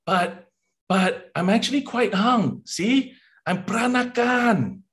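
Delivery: high-pitched, insistent protest; pleading Singlish suitor. Acted. Rejected Singaporean making their case, high pleading.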